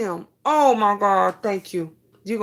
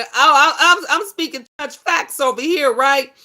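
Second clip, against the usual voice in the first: higher, louder, laughing through the words; 7 semitones higher, 8 dB louder than usual. Real, not acted. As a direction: laugh while bragging playfully. Laughing at her own comment.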